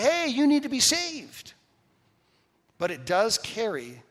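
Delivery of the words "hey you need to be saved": high, loud call to a passer-by; mock evangelising shout. Mimics accosting people to evangelise.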